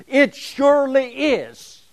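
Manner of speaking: high, loud and slow "surely is"; emphatic declaration. Shown as a demonstration. Lecturer explaining the Hebrew particle, emphatic declaration. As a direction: declare "surely is" with full force. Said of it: "This is an emphatic declaration".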